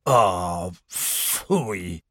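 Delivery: low, fading grumble; dismissive. A narrator's reading. Owl reading a warning on a placard, dismissive. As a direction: brush it off in a low voice.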